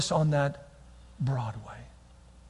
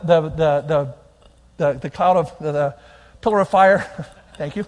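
ordinary talk

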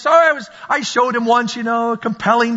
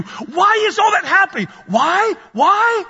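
building shout; 9 semitones higher than usual. Real, not acted. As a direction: climb to a shouted why. Preacher building rhetorical questions.

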